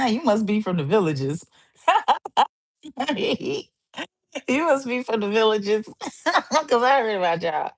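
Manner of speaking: laughing through the teasing; cackling at the villagers. Real, not acted.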